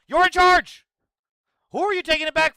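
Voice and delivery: shouted rebuttal; exasperated yell. Real, not acted. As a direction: throw it back at them, incredulous. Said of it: "exasperated retort".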